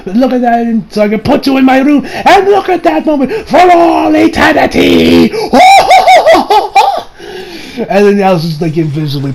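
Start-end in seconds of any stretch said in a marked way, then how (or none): 0.1-5.3 creepy theatrical rapture rising to a shout; gloating villain. Retold or imitated. Villain gloats in acted quote. 5.4-7.7 maniacal mock laughter; long squealing cartoon cackle; high villain cackle.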